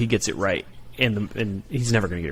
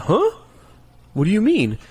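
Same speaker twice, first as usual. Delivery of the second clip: put-on higher, louder puzzled kid; clueless kid; 11 semitones higher, 5 dB louder than usual. Daughter looks at her father, puzzled, higher and louder. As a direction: sound like a confused little kid. Young daughter not understanding.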